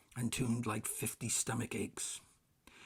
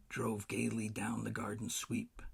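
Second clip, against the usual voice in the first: ordinary delivery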